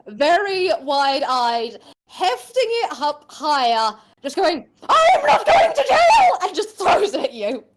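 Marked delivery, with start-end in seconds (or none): 4.9-6.4 high, loud panicked yell; shrieking refusal. Acted. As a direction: scream it like a cornered thief. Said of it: "Did she just yell".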